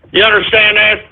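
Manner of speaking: ordinary talk, nothing marked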